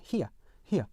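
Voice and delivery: "here" as "hi-uh", no R; British "here" diphthong without R. Shown as a demonstration. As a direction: say "here" British-style, gliding into a weak schwa. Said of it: "어 사운드가 약하게 있어요".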